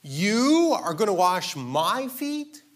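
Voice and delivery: high, loud, slow, stretched-out disbelief; incredulous Peter. Retold or imitated. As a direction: act out Peter's shocked protest. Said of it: "it's more emphatic in the, the Greek text".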